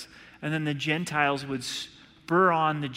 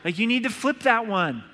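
higher, louder mock backseat advice; nagging grasshopper; 6 semitones higher, 4 dB louder than usual. Grasshopper nags a dad at his barbecue, mock nagging. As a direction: play a tiny know-it-all critiquing the grill. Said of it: "It's like".

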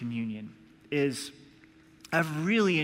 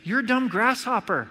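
mock dismissive dad; 6 semitones higher, 3 dB louder than usual. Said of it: "It's like".

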